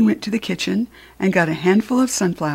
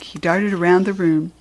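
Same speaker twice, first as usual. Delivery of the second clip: ordinary delivery, nothing marked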